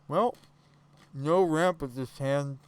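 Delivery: talking through a mouthful of food; mouth full. Real, not acted. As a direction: say it while chewing. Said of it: "I'm eating with my mouth full".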